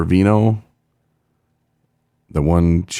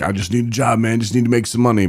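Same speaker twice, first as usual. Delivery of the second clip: higher-pitched mock pleading; higher put-on quote voice; mock pleading rehab patient; 6 semitones higher than usual. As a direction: imitate someone begging for work.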